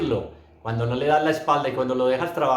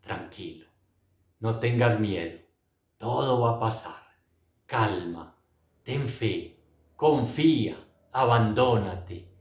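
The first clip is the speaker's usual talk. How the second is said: gentle reassurance. Retold or imitated. The Lord comforting him in his arms, gently reassuring. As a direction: soothe as if holding someone, one short phrase at a time. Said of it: "me tomaba en sus brazos y me decía".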